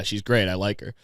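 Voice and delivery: ordinary talk, nothing marked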